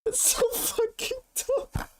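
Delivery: high, slow words squeezed out through crying laughter; can barely get it out for laughing; real helpless laughter, voice cracking high; laughing to tears. Real, not acted. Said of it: "I actually have tears".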